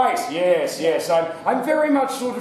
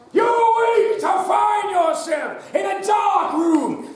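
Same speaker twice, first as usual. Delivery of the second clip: dramatic announcer; 11 semitones higher, slower than usual. Acted. Host opens the adventure game in a big announcer voice. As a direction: boom it out slowly to the room.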